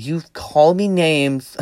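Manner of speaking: slow, high mock-wounded complaint; mocking poor-me voice; playing the victim. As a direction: imitate self-pitying hurt, stretched out. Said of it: "playing the victim role really well".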